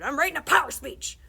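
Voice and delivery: loud, high holler, POWER punched. Acted. Principal hollers at the salesman, loud. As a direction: yell it in pure irritation. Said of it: "Barkin hollered".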